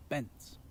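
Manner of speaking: Bens said softly as a little plop; bounce sound. Shown as a demonstration. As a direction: drop Bens like a tiny bounce. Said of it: "a bounce or a fall".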